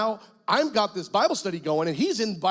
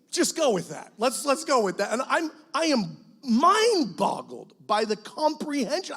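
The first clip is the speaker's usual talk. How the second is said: normal talking voice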